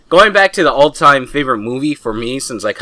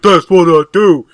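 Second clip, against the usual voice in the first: wrestler quote; 6 semitones higher, 10 dB louder than usual. Retold or imitated. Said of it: "He's like".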